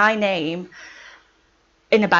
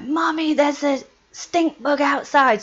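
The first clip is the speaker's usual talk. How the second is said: little son; 5 semitones higher than usual. Retold or imitated. Mother voicing her son announcing a stink bug, childlike. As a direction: imitate an excited small boy. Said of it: "my son comes running in one day and he's like".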